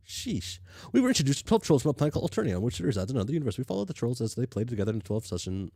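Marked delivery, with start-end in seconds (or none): 0.9-4.9 fast reading. Real, not acted. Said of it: "read this as fast as I can".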